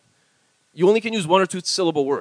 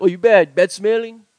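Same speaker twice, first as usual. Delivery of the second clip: loud, higher Hawaiian Pidgin jibe; mock Pidgin come-on turned teasing, louder; 4 semitones higher, 7 dB louder, slower than usual. Shown as a demonstration. Co-host jokes with a pickup line, loud Pidgin teasing. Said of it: "Or you could be like".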